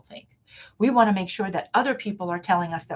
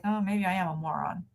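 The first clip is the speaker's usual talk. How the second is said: meek self-doubt; 3 semitones lower, 6 dB quieter than usual. Retold or imitated. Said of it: "you go like".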